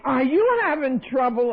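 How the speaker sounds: high, loud call down the stairs; imitated high-pitched shouted question. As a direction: call it out to someone below. Lady calls down the stairs.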